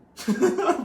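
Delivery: short burst of high-pitched laughter. Real, not acted. Laughing at his own reaction.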